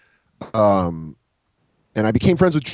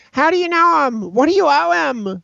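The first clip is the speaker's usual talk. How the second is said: much higher, squeaky suspicious put-on voice; suspicious friend impression; 3 dB louder than usual. Best friend imagined grilling him about a guest, in a high suspicious voice. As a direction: grill him like a wary gatekeeper. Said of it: "And he'd be like".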